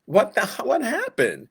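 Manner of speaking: high, startled mock bewilderment; confused surprise. People judged by God, mock startled confusion. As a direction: blurt it out caught off guard. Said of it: "we're so confused".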